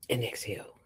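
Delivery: soft whispered and exhale; gentle whispered instruction; whispered exhale cue. Shown as a demonstration. Breathing exercise instruction.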